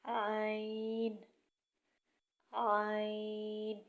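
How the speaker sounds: عین drawn out slowly from the throat; throaty ain. Shown as a demonstration. Teacher showing the throat letter slowly. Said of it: "زور لگا کر عین کی آواز نکالیں".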